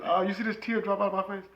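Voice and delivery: ordinary talk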